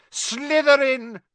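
the hat shrieks the house at once; hat screaming. A narrator's reading. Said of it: "it screamed".

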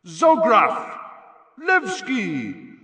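high, loud shouts building to a big last name; announcer's roll call. Acted. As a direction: belt out each name, stretching the build-up to the star. Announcer calls out players' names.

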